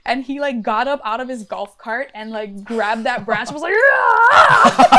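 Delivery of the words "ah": a sudden high, loud yell; mock yell. A dad yelling at the branch that hit his daughter, high loud yell. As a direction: bellow back at the branch that hit her.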